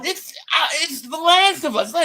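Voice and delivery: high, flustered squeak of a caught husband; panicked high excuse. Retold or imitated. Husband caught by his wife, squeaky panicked excuse. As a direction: blurt it out high like you've been caught.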